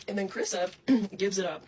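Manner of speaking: normal talking voice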